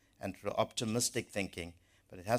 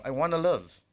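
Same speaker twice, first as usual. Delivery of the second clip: louder, higher cry of I wanna live; emphatic declaration; 7 semitones higher, 9 dB louder than usual. Real, not acted. Preacher to his church, declaring loudly and higher. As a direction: proclaim it to the congregation.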